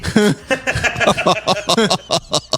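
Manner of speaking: helpless run of laughs after a punchline; real laughter. Real, not acted. Friends on a podcast hearing a roast joke, real laughter.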